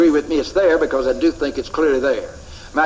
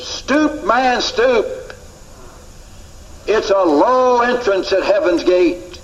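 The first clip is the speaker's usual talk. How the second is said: high, slow urging to stoop; cartoon caption; 5 semitones higher, slower than usual. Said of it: "he just wrote these words".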